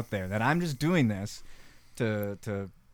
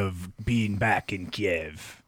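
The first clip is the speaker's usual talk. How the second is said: put-on very slow, louder nostalgic Russian accent; hammed-up wistful Russian accent; 3 semitones lower, 7 dB louder, much slower than usual. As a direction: sigh it like a homesick Soviet agent. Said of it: "honestly pretty good accents".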